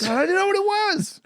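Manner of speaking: squeaky falsetto exclamation; high squeaky voice. Acted. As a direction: pitch way up and squeak it.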